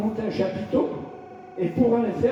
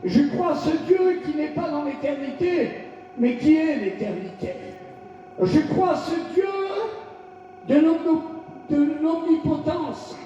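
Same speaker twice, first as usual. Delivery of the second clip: fervent, rising sermon cry; shouted declarations of faith; full-force preaching; 5 semitones higher than usual. Acted. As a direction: proclaim each line to the congregation.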